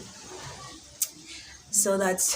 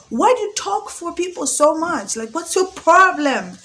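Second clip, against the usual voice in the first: higher and louder, scolding mother; exasperated mom; 8 semitones higher, 9 dB louder than usual. Retold or imitated. Her mom scolding her for defending people. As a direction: nag like a fed-up mom. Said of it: "my mom literally sometimes will be like".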